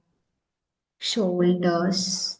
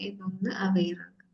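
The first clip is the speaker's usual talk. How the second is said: whisper; 8 dB quieter, faster than usual. Acted. Guiding awareness in meditation.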